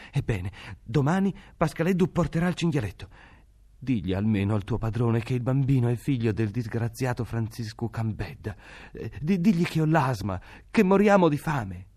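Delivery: a mother afraid for her jailed husband pleads; pleading poor mother. A narrator's reading.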